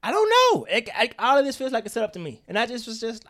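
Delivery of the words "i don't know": high, loud incredulous burst; exasperated outburst; loud exclaim. Real, not acted. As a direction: throw the doubt out loud. Exclaimed doubt.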